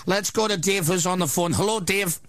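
hammed-up Northern Irish radio host voice; mock Northern Irish accent. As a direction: play a brash Belfast shock jock with a shaky Northern Irish accent. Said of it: "The accent's all over the place".

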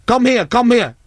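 high-pitched mock guard calling someone back; imitated summons. Security guard summoning two staff back, officious imitation.

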